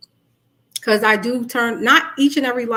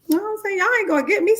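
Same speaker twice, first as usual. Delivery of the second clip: high aside; 10 semitones higher, much faster than usual. Real, not acted. Emphatic aside about Brooklyn.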